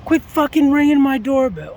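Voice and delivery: angry shout at the woman ringing his doorbell; angry yell. Retold or imitated. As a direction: bark it at the stranger. Yelling at the intruder.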